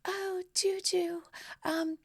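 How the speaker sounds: breathy-sounding gentle whisper, high up; soft high Janet voice. Retold or imitated. Janet offers her freezing guest a sweatshirt, softly and high. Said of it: "And she's like".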